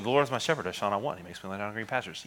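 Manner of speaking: fast, careless rattle through the verse; breezing through. Shown as a demonstration. Pastor mocking how people rush familiar scripture, fast and offhand. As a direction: skim it like you've heard it a million times. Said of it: "the tendency is to just breeze through it".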